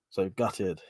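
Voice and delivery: sarcastic gutted. Shown as a demonstration. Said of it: "when I say gutted, it's like a sarcastic one".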